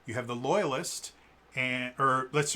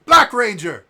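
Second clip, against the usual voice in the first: high and very loud at the end; heroic declaration; 6 semitones higher, 13 dB louder than usual. Retold or imitated. One more civilian standing up, loud heroic claim. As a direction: build to a big proud finish. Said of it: "Another one".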